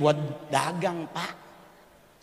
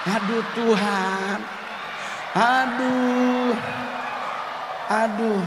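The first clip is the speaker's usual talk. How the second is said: panicked moaning; 6 semitones higher, 3 dB louder, slower than usual. Acted. Panicked embarrassment at koteka.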